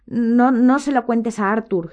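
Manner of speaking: high, slow, tearful plea; whimpering. A narrator's reading. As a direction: beg through a whimper. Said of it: "gimoteaba la señora Weasley".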